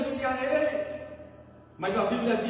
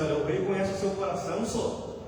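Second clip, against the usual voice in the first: Mineiro accent; 5 semitones lower than usual. Shown as a demonstration. Preacher showing the congregation how to tell a neighbour, Mineiro accent. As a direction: say it low and casual, like a Mineiro. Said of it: "Vamos falar igual mineiro?".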